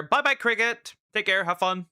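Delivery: high, bright sign-off; cheerful goodbye. Real, not acted. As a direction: wave them off cheerfully.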